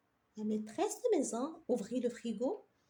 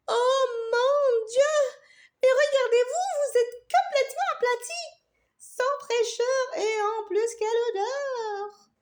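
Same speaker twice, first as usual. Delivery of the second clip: high, loud, scornful exclamation; disdainful; 9 semitones higher, 6 dB louder than usual. Acted. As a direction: look down on them, appalled. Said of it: "avec dédain".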